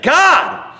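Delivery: high, loud cry to God; hollered at full volume. Retold or imitated. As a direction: holler it as loud as you can. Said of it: "I hollered, I said".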